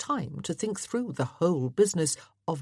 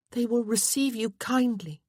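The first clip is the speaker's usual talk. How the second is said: higher-pitched, insistent wife; 6 semitones higher than usual. A narrator's reading. Wife promising husband a kind welcome, insistent. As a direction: assure him in a higher voice.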